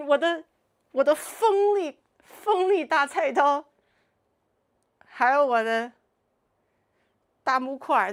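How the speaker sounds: a cartoonish cleaver threat; mock menacing line. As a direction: drag it out like a playful villain.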